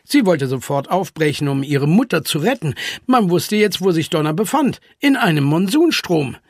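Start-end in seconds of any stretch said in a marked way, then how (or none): none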